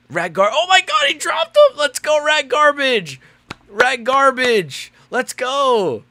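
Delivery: high, loud cheering for a knockdown; excited cheering. Real, not acted. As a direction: cheer the fighter on, pitch way up.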